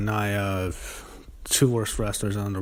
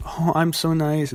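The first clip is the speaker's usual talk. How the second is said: sugary mock-innocent voice, higher and slowed; mock sweet; 7 semitones higher, slower than usual. Mocking Alexa Bliss's fake-nice apology promo, mock sweet. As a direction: sneer through a fake-nice act. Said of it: "She does the thing where she's nice".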